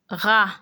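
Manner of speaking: "ra" said as French rah, no T; silent final T. Shown as a demonstration. Teacher repeats it for learners, T left silent. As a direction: say "ra" ending on the open vowel. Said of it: "You don't pronounce the T".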